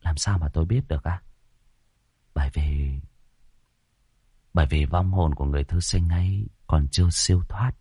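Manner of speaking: low, soft, chilly reply; cold. A narrator's reading. Youth telling the woman a ghost is on her, cold. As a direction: speak coldly under a faint smile, fading towards a whisper. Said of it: "Cậu mỉm cười lạnh lùng".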